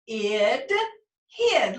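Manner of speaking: teacher blending "hid" with a short i; short vowel blend. Shown as a demonstration. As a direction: sound out "Id", then blend into "hid". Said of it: "say its short sound".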